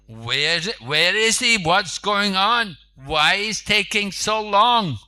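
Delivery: loud, high, impatient calling out; impatient demand. Acted. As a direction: demand it, fed up with waiting. Impatient lord asks for Shivananda.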